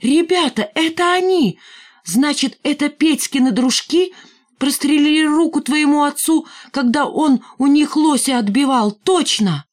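put-on high, agitated boy's outburst; rally the gang with a breakthrough realization; excited accusation. A narrator's reading. Рыжий tells the gang about Петькины дружки, excited.

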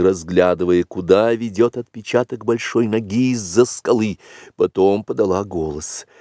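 usual speaking voice

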